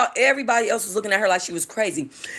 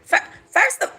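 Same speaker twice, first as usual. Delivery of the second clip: starts very high then breaks off; a failed squeaky start; attempted high voice. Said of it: "so country bumpkin and high pitched".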